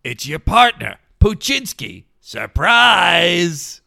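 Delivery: loud goofy talking dog; big reveal as a cartoon dog. Voicing the dog revealing itself.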